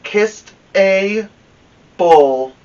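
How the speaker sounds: ordinary delivery, nothing marked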